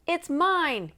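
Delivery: loud, high retort thrown over the shoulder; flung back. A narrator's reading. Grizzleface flinging it back at Nancy as he runs, loud and high. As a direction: toss it back defiantly while running. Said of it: "he flung over his shoulder".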